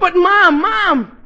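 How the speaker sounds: loud, high cry after his mother; calling after her. Acted. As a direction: call her back urgently.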